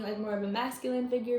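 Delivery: usual speaking voice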